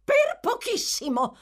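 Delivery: high, loud shrill yell; shrill shout. A narrator's reading. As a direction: shriek it right in his ear. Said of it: "urlò una voce stridula".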